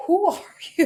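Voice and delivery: put-on high, loud toddler voice. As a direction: play the baby staring at a stranger, high and loud. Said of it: "Chloe looks at her like".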